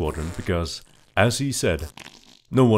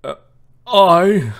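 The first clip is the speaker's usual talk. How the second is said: nervous groom; 12 semitones higher, 9 dB louder than usual. Acted. Groom starting, then farting.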